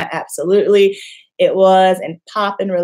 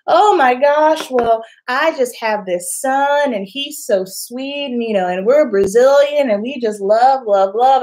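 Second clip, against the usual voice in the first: gushing Brazilian mom; 4 semitones higher than usual. Retold or imitated. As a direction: coo it like a proud mom selling her son. Brazilian mom praises her son.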